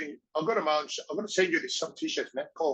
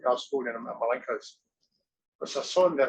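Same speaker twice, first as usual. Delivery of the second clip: normal talking voice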